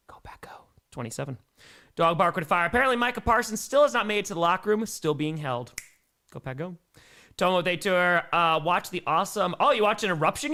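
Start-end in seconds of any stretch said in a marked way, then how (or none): 0.1-0.6 voiceless mock-secret chant; hushed Go Pack go; whispered cheer. 6.3-6.8 much softer little chant; quiet Go Pack go; soft cheer. Acted.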